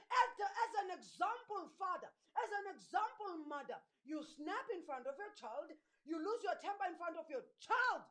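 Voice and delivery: forceful preaching. Real, not acted. Preacher rebukes parents who never learned to say sorry, forceful preaching. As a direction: drive it home to the congregation.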